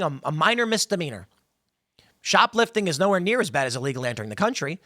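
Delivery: normal talking voice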